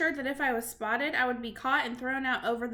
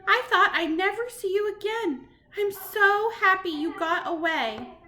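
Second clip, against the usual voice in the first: tearful relief; 7 semitones higher, 6 dB louder than usual. Acted. Grandmother hugs grandson.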